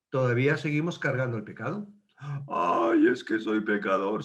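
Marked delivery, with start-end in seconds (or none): 2.5-4.2 mock lament.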